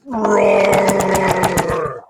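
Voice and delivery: long, loud, drawn-out dragon roar. Acted. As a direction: let the roar fill the room. Dragon roars in battle.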